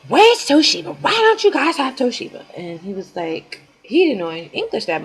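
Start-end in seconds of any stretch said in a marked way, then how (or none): 0.1-2.4 higher, louder mock demand. Friend demands Toshiba from a store clerk, loud mock anger. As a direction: bark it at the clerk, imitating her. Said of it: "she grabbed somebody".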